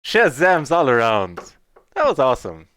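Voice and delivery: loud, thrilled celebration; real burst of triumph; excited cheer. Real, not acted. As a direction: shout the win out with joy. Big win celebration.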